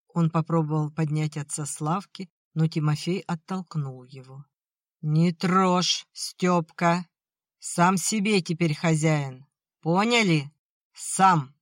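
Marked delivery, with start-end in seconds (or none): 5.0-11.5 put-on loud, defiant drunk yelling; drunken angry shout. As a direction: shove them off and shout. Drunk father pushes son away.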